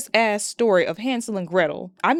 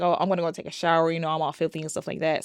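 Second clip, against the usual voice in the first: breezy quick; 3 semitones lower, much faster than usual. Retold or imitated. Quoting Nikki breezily.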